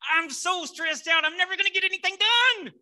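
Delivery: very high, full-volume stressed yell; yelled out loud. Retold or imitated. Preacher reenacts coming undone while writing the talk, a stressed loud yell. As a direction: yell it out at breaking point. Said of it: "I just yelled out loud".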